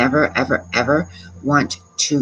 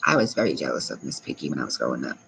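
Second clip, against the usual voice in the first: low quiet repeat; 13 semitones lower, 7 dB quieter, faster than usual. Real, not acted. Streamer repeating her childhood jealousy to chat, sheepish low mutter. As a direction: lower and much softer, fast, like a sheepish aside.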